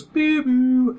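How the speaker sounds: high imitated door-chime tones; door chime beeps. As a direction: beep it like a cheap chime. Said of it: "it goes".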